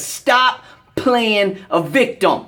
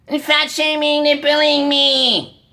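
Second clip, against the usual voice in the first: mocking whiner; 5 semitones higher than usual. Fitness ranter imitating people who cry bullying, mocking higher whine. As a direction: parrot the complainers with contempt. Mocking critics of fat shaming.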